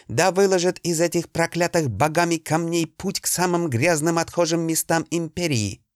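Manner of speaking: high, heated tirade of insults; angry cursing. Acted. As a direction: spit out the curses in anger. Cursing at the wall's maker.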